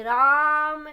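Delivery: a long held राम; prolonged vowel. Shown as a demonstration. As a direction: stretch the आ in राम well past its normal length. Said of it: "कैसे बोलेंगे?".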